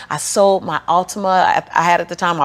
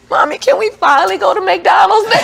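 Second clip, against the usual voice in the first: put-on much higher, louder child voice with a laugh; little son voice; 12 semitones higher, 6 dB louder than usual. [name] voicing her five-year-old son asking for McDonald's. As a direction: play the eager little boy. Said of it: "My son said".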